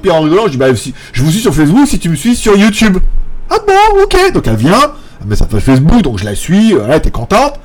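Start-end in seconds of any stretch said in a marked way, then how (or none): 3.5-4.3 squeaky high imitation of the boss lady; high mock patronne voice. Imitating the café owner surprised by his phones, squeaky and high. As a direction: pitch way up, playing the surprised woman. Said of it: "owner replies".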